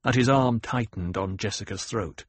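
ordinary delivery

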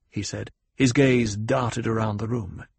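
ordinary delivery, nothing marked

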